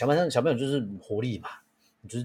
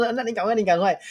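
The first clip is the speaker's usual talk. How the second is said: higher, louder hurry-up; mock eager urging; 11 semitones higher, 6 dB louder than usual. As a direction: cheer him on with fake zeal. Urging child up slide.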